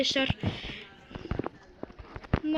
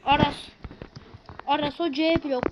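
ordinary talk, nothing marked

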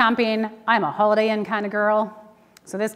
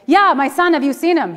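higher, louder urgent plea; anxious mother calling, higher and louder; frantic; 5 semitones higher, 5 dB louder than usual. Retold or imitated. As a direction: blurt it out in a panic. Frantic mother searching for lost son.

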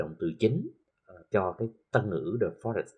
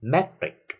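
"Metric" with a high, loud MET; stress on MET; 7 semitones higher, 5 dB louder than usual. Shown as a demonstration. Teacher drilling -ic words, MET stressed in "Metric". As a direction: punch the first syllable of "Metric". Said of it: "sẽ được nhấn âm ngay phía trước".

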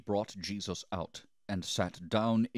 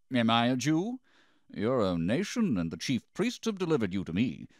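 higher, louder indignant question; sharp retort; 6 semitones higher, 5 dB louder than usual. A narrator's reading. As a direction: throw it back at the prisoner. Pilate retorts to Jesus.

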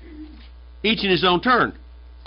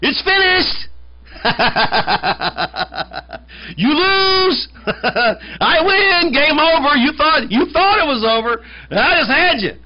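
much higher, louder triumphant cry with gloating laughter; triumphant Yeshua; 11 semitones higher, 4 dB louder than usual. Acted. Preacher voicing Yeshua taunting the devil from the cross, triumphant. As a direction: shout your victory over the beaten devil. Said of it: "what does he say when he dies".

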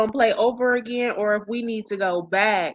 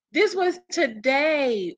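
ordinary talk

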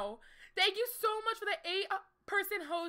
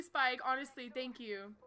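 ordinary talk, nothing marked

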